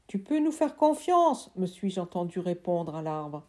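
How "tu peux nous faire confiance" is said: put-on higher apologetic child voice; a kid pleading to be heard; boy's voice. Boy apologising to his uncle, higher pleading voice.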